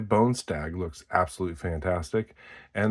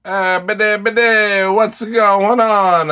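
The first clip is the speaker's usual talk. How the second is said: high, loud hammed-up Italian accent; 13 semitones higher, 10 dB louder, slower than usual. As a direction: ham up a loud Italian voice with a laugh. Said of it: "that was my Italian accent".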